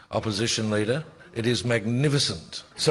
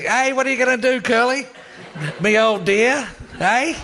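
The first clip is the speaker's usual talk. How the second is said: higher and louder mocking parliamentary jeer; imitating Keating; 12 semitones higher, 5 dB louder than usual. Telling the panel how Keating taunted Downer, mocking jeer. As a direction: ham up a gloating Keating taunt. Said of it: "and he comes like".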